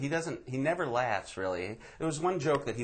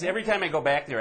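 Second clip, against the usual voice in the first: normal talking voice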